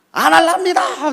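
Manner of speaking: high, very loud, drawn-out refusal; acted defiant outburst; defiant shout. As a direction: shout back at your superior. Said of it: "목사님한테 막 큰 소리 치면서".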